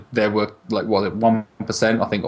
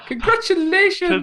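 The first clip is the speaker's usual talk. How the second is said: high, slow sing-song congratulations; mock creepy cheer; slower than usual. Xbox tweeting Sony on launch day, high sing-song cheer. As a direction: gush it out with a creepy grin.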